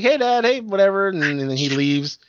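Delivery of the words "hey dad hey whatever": higher, louder cheery daughter greeting Dad; all happy. Retold or imitated. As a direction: imitate a happy girl greeting her father. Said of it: "she's all happy".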